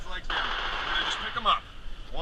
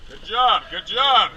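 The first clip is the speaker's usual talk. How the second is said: praise shout; 8 dB louder, much slower than usual. Real, not acted. Praising after carrying wounded.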